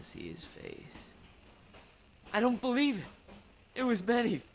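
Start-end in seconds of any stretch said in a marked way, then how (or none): 2.3-4.4 stunned as the mask comes off; shocked disbelief. Acted. Unmasking the killer, shocked.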